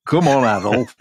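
playful mock Yorkshire "hey up"; broad Yorkshire call to a child; jokey Yorkshire dad voice; Yorkshire accent. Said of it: "in that accent".

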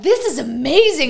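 high, loud burst of wonder; amazed excitement. Retold or imitated. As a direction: gush like an awestruck newcomer. Acting out awed newcomer's reaction.

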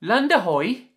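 high, loud call from above; holloed call. Acted. Walter spotting land from the crow's nest, loud high call. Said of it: "Welsh Walter holloed".

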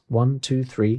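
plain reading voice

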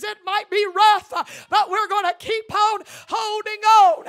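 loud, driving sermon delivery; preaching at full force. Acted. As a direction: exhort the church with fire.

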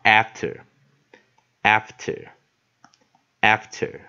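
after said slowly with an er ending; pronunciation demo. Shown as a demonstration. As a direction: model after three times, slowly, leaving space between. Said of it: "我们这边写一个er". (慢速示范 after，词尾读 er)

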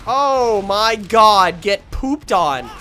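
loud, drawn-out taunt; big stretched-out victory call; gloating shout. Real, not acted. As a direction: crow over the beaten opponent. Gloating after a kill.

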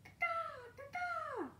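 very high, stuttered bird caws; piercingly high magpie call; magpie cawing. A narrator's reading. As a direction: squawk in doubled caws. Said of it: "calls the magpie".

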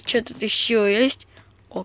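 ordinary talk

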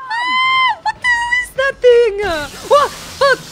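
high, loud terrified scream into curses; screaming high and loud in real panic; panicked screaming. Real, not acted. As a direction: scream in real fright at the monster. Monster appears, screaming.

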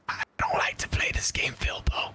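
breathy confiding whisper. Pretend. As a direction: whisper it like a secret. Mock confession in whisper.

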